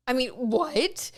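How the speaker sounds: slow, stunned disbelief; drawn-out baffled reaction; jaw-dropped at what she said. Real, not acted. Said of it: "Like, w-, hold on".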